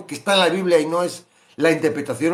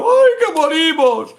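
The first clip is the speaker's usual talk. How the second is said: high, loud, slow wail of despair; hammed-up fear of drowning; mock panic; 14 semitones higher, 7 dB louder, slower than usual. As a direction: disciples cry out they will die.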